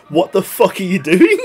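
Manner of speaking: high, loud imitated yell breaking into laughter; mock shouting. Retelling Carl shouting in costume, laughing mock yell. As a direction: shout it as an outraged colleague, on the edge of cracking up. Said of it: "Rigor Mortis was shouting at me".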